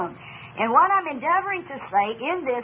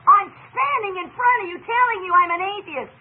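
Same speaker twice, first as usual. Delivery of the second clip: retold indignation, higher and a bit louder; livid atheist; 7 semitones higher, 4 dB louder than usual. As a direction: push back like an offended atheist. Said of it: "He was just livid".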